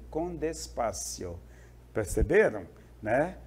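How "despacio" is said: softly drawn-out "despacio"; slowly. Shown as a demonstration. Teacher describing the recorded speaker's slow speech, slowly. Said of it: "De falar devagarzinho".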